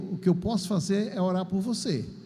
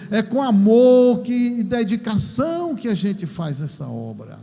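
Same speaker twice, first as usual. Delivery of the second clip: louder, driving sermon cadence; fervent preaching; 7 dB louder than usual. Acted. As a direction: preach it with full force to the congregation. Preaching at full force.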